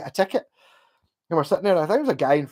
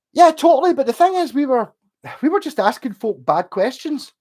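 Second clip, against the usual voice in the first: excited; 9 semitones higher, 6 dB louder than usual. Real, not acted. Excited story opening.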